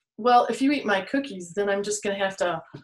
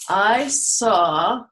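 usual speaking voice

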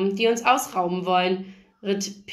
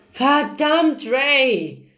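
raised, higher exasperated outburst; snapping in anger; 5 semitones higher, 4 dB louder, much slower than usual. A narrator's reading. Narrator tells off the brother Ray, angry outburst. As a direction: lash out at him, louder and higher. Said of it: "ging ich ihn an".

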